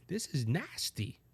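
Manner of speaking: low, very soft retold disgust; hushed disgust. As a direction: drop right down, quietly appalled. Quotes own first reaction in disgust.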